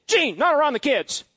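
high, loud scolding aunt; a shocked aunt hushing a cussing uncle; aunt's scolding. Retold or imitated. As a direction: scold him sharply to mind the kids. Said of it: "Just how she'd say it".

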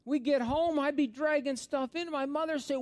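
high, loud preaching voice; fired-up pulpit delivery; full-force preaching. Acted. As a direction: preach it out big to the congregation.

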